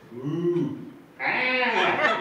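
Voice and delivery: half-moo, half-bleat animal imitation; goat mooing like a cow. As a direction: start as a cow, jump up into a goat scream. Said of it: "halfway through his thing".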